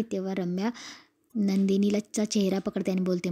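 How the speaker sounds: normal talking voice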